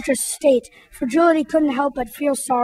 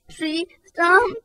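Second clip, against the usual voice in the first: higher hurt wail; 5 semitones higher than usual. Acted. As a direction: cry out the words in hurt. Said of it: "wailed Fragility".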